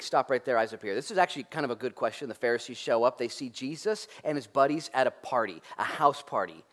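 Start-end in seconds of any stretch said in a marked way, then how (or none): none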